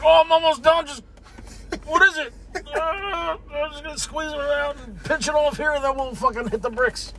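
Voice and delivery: high, strained little dog voice; pooping dog voice. Acted. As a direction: squeak it like a dog hurrying to finish. Voicing the dog mid-poop.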